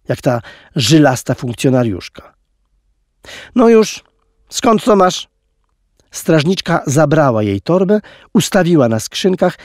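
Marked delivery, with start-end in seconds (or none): 3.5-5.3 high, louder guard demanding answers; stern guard. A narrator's reading. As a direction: snap the question like a guard. Guard questions the girl.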